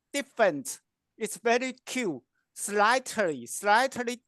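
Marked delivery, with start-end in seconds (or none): none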